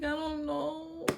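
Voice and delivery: high, slow, helpless reply; panicked answer. Retold or imitated. Student answers the principals in a high, helpless panic. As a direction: drag it out high and lost. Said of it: "panicking, crying".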